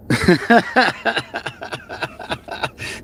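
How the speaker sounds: long staccato laugh. Real, not acted. Streamer reacting to the clip just played, laughing. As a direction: let a run of laughter out.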